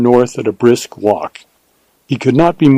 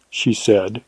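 ordinary delivery

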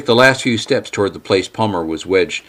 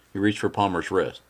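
plain reading voice

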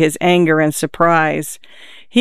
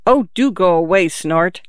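plain reading voice

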